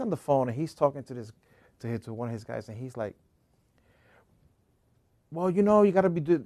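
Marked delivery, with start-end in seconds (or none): 5.3-6.4 put-on higher-pitched Dominican accent; heavy Dominican accent. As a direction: imitate a Dominican barber on the phone. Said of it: "he's very heavy Dominican accent".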